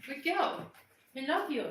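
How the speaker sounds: high, soft praise; affectionate pet talk. Real, not acted. Praising the pet, tender high pet talk.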